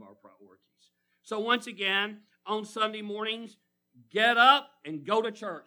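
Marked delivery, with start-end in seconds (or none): 4.1-5.6 rally the congregation; loud call. Real, not acted. Preacher calling the church to rise, loud. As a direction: get up and go to church, called out loud. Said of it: "Say that with me".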